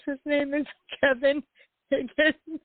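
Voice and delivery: high, laughing through the name; can't stop giggling while saying it. Real, not acted.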